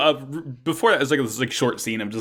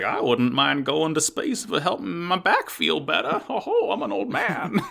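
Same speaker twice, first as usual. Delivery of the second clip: put-on slow, laughing old prospector drawl; creaky old-timer, chuckling through it; old-timey prospector accent; 6 semitones higher than usual. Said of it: "my fucking, like, old-timey prospector accent".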